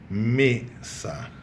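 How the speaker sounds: "mesa" as ME, pause, sa; teacher splitting "mesa" after stressed ME; penultimate syllable stressed. Shown as a demonstration. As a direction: stress the first syllable of "mesa" and detach the second. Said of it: "a sílaba tônica é a penúltima".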